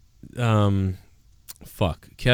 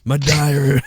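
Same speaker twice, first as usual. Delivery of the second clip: Jake Roberts impression. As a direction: reminisce as a hammed-up old-school wrestler. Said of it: "it's me, Jake Roberts".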